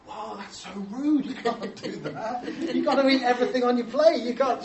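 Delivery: mock shocked scolding. Guests mock-scolding Sabina for leaving food, half-laughing. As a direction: play the appalled guest, tongue in cheek.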